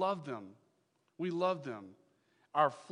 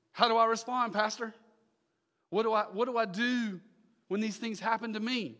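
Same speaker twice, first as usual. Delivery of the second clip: high, loud pleading question from a congregant; congregant asking; 8 semitones higher, 9 dB louder than usual. Acted. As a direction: ask the pastor like a desperate churchgoer. Voicing a congregant asking pastor.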